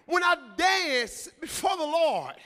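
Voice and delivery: loud, slow, drawn-out proclamation; full-force preaching. Real, not acted. Preacher declaring worship rests on hunger for God, full-force preaching. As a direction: hammer each phrase, pausing between bursts.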